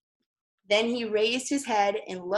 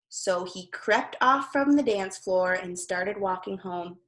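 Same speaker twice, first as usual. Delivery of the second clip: ordinary delivery, nothing marked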